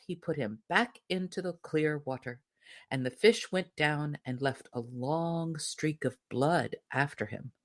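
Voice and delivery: ordinary delivery, nothing marked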